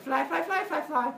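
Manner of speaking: tiny high-pitched insect voice; buzzing fly. Acted. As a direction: chant it like a little fly.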